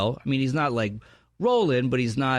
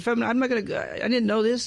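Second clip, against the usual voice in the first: higher-pitched retelling of his own words; voice goes up; 8 semitones higher than usual. Retold or imitated. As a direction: let the voice climb up high. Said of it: "your voice goes up like this".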